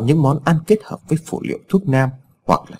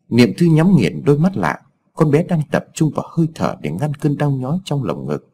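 plain reading voice

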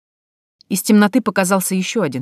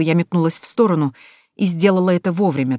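ordinary delivery, nothing marked

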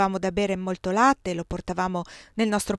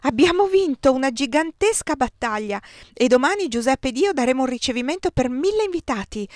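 high, louder, rushing with excitement; breathless excitement; 5 semitones higher, 4 dB louder than usual. A narrator's reading. Giulia bursting in with victory news, breathless and excited. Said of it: "arrivò addirittura senza fiato".

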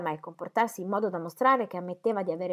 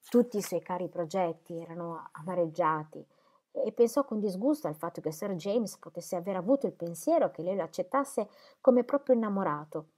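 usual speaking voice

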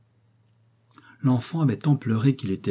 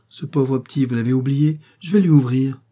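eager, pitched up and louder at the end; 4 semitones higher, 5 dB louder than usual. A narrator's reading. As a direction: rush to the child's rescue. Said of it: "empressée". (empressée, la voix qui monte)

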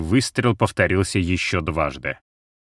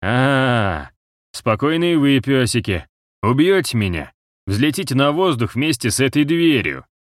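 threatening taunt; 5 semitones higher than usual. A narrator's reading. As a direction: taunt them with the bomb. Traitor threatens the guards.

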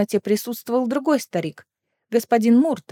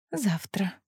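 very soft, tender single word; soft intimate murmur; 10 dB quieter than usual. A narrator's reading. Lissandra gently stops her lover's kiss, soft and intimate.

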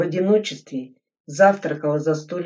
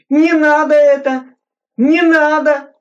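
high, loud, desperate plea; sobbing cry; 11 semitones higher, 11 dB louder than usual. Acted. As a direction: cry out begging through a sob. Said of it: "всхлипнув, закричал Крынкин".

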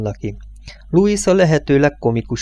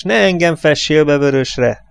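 raised, higher protesting voice; shouted complaint; 5 semitones higher than usual. A narrator's reading. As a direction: call it out at a workmate. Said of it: "kiáltott rá a fényszoron dolgozó munkás".

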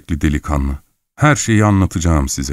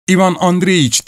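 calling out a name; 10 semitones higher than usual. Acted. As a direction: call as if summoning someone. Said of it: "diye seslenin".

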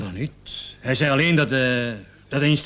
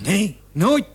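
much higher, louder outraged "nooit"; indignant denial; 7 semitones higher, 5 dB louder than usual. Acted. Suspect denies knowing murdered girl. (verontwaardigd, veel hoger en luider)

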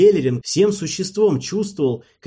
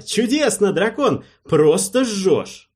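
much higher, thrilled praise; delighted cheering; 7 semitones higher, slower than usual. Acted. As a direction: cheer him on, overjoyed. King cheers the dragon.

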